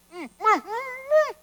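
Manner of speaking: high, gagged "mm" protests; muffled mumbling. Acted. Marionette gagged with a sock, muffled protest. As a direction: try to talk through a mouthful of sock. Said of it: "trying to talk with the sock wedged between his wooden lips".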